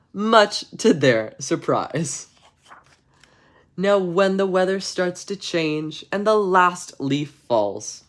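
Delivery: slow, measured bedtime-story reading; story narration. Acted. Streamer reading a bedtime story to chat, storybook narration. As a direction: read it like a storybook to kids.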